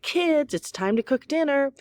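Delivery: put-on high, carrying call to the kids; dad hollering that dinner is starting. A narrator's reading. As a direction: call them down from upstairs.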